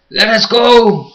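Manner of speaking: high, drawn-out pleading yell; shouting. Acted. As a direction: scream it at the captors. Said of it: "Jim shouted".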